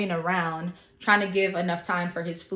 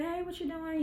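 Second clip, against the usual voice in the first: put-on higher, much softer girl voice; girl's greeting; 10 semitones higher, 10 dB quieter than usual. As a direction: voice the friend higher and hushed. Girl at the bar greets her.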